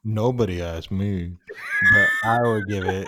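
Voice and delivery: drawn-out mock imitation of a co-host; mocking echo. Co-host parrots "nobody asked me" back, mocking and slow. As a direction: stretch it out to tease them. Mockingly repeats the co-host's complaint.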